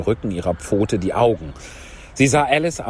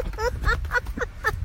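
high giggle; laugh; 6 dB quieter than usual. Real, not acted. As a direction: burst into high, soft giggles. After jumping about for fun. (hohes Kichern)

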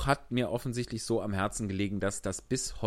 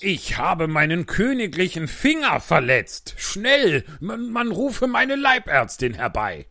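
high, loud, fussy complaint; whining king; 6 semitones higher, 7 dB louder, slower than usual. Acted. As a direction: whine like a spoiled king. King hurt his finger.

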